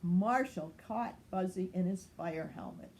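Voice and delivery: ordinary delivery, nothing marked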